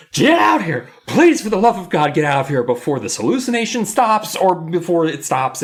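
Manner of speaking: high, loud mock-frantic shout; yelling for wife. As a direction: call out desperately, hammed up. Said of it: "he starts yelling for his wife".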